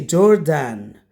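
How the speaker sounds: "Jordan" with a full second vowel, jor-DAN; wrong pronunciation. Shown as a demonstration. Teacher copies what some students say, "Jordan" ending in full "dan". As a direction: give "Jordan" a clear "dan" like the name Dan. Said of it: "That's wrong".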